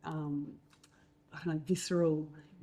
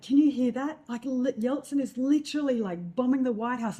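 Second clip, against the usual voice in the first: higher, louder breaking-news urgency to mum; re-enacted excited call; 7 semitones higher, 5 dB louder than usual. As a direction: report the battle down the phone, worked up. Acting out excited call from war.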